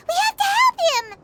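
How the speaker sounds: high, loud, panicked cry for help; alarmed urgency. Acted. Paimon finding Tighnari fainted, alarmed and urgent. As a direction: sound the alarm, he's collapsed.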